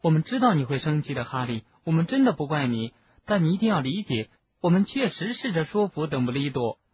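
plain reading voice

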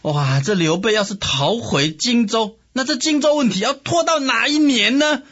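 high, climbing exasperated wail; angry outcry. A narrator's reading. Sun Quan fretting over Jingzhou, high exasperated outcry. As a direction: keep rising in outrage. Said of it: "气得大叫".

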